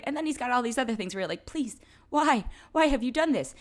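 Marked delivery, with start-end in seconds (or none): 1.5-3.5 higher-pitched mock pleading; exasperated plea.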